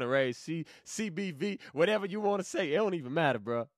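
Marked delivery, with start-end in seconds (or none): none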